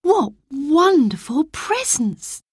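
put-on high, slow, wide-eyed girl's voice; a young girl gasping at her gifts; a girl in awe of the gifts; delighted girl. A narrator's reading. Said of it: "added Roberta".

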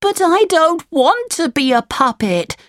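put-on high, protesting puppet boy voice; Pinocchio, crying out. A narrator's reading. Pinocchio protests to the fairy, high crying-out voice. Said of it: "cried Pinocchio".

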